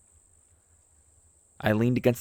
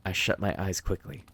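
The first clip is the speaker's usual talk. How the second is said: ordinary delivery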